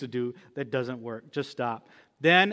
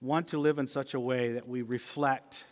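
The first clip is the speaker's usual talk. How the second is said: soft, earnest prayer voice; closing prayer; 3 semitones lower, 4 dB quieter than usual. Acted. Pastor leads the church in prayer, softly. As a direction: pray quietly over the congregation.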